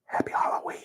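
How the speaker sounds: hushed, eerie Halloween whisper; soft, creepy sign-off; spooky whisper. Pretend. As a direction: whisper it like a playful horror host.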